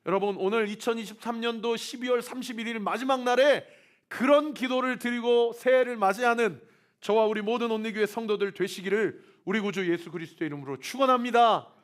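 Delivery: loud, high benediction; preaching blessing. Acted. Pastor blesses church members at year's end, loud and high. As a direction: bless them in Jesus' name with full force.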